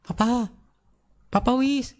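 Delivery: high-pitched mock call for Papa; retold child calling out; imitated child's yell. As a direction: call out high like a little kid wanting Dad. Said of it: "Kok dia teriak".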